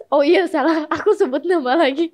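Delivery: normal talking voice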